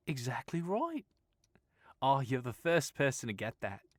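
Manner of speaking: put-on breezy Australian girl voice; easygoing Australian woman reassuring Stephen; Australian accent. Sylvia answering.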